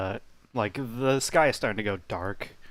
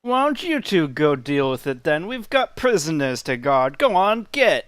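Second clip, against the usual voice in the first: loud guard shooing people away, pitch jumping up; gruffly dismissive guard; 5 semitones higher, 6 dB louder than usual. Acted. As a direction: send them packing with a raised voice. Guard brushes off the request.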